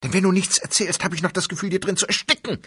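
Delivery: a frantic high outburst; urgent plea. Acted. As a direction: rush it out, desperate to be heard. Wife pleads to be let in.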